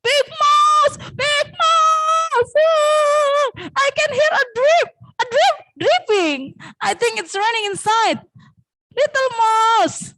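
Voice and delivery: very high, squeaky alarmed mouse; little mouse calling. Acted. Little mouse calls Big Mouse about dripping, high alarmed cries. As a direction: cry out high, stretching the words. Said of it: "dan lalu manggil".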